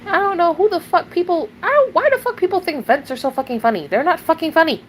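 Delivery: real indignant fury; furious outburst. Real, not acted. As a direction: burst out again in rage.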